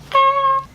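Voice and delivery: high, loud squeal of delight; excited squeal. Retold or imitated. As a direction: squeal like a package just arrived. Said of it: "I get so excited, and I'm like".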